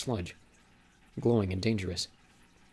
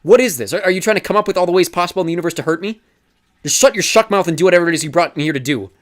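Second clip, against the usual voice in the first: high, loud, furious yelling; furious shout; 8 semitones higher, 11 dB louder than usual. Acted. Thomas raging at Teresa for betraying him, furious shout. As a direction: rage at her, louder and higher all through. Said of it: "His chest heaved with some angry breaths".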